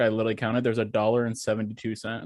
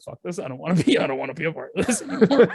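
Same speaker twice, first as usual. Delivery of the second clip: fed-up whine; 5 semitones higher, 3 dB louder than usual. Retold or imitated. Imitates mom giving up.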